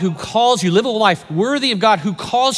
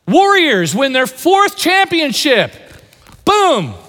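excited cheer; 8 semitones higher, 4 dB louder, slower than usual. Acted. Pastor celebrating team's championship.

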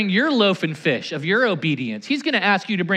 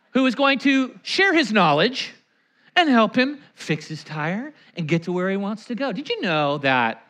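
ordinary talk, nothing marked

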